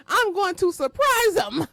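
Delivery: giddy promise; gleeful threat. Real, not acted. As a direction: savour the threat with glee. Gleeful threat after laugh.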